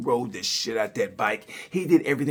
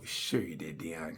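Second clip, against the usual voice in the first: sarcastic reply; 7 semitones lower, 10 dB quieter, slower than usual. Retold or imitated. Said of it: "And you go".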